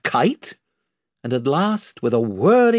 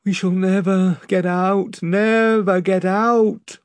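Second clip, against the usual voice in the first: higher, slow despairing moan; 6 semitones higher, slower than usual. A narrator's reading. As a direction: rowers giving up hope. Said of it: "moaned the rowers".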